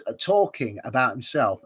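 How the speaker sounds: ordinary talk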